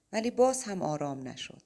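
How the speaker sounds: ordinary delivery, nothing marked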